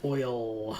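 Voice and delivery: "Oil" stretched out into a long, full L; pronounced L. Shown as a demonstration. Player demonstrating how some people say it, "Oil" with a long L. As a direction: hold the L at the end of "Oil". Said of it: "really pronounce the L".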